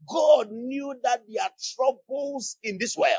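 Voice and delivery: normal talking voice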